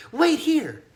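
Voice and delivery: put-on high, bright girl's order; bossy little girl; young girl voice. A narrator's reading. Girl instructs.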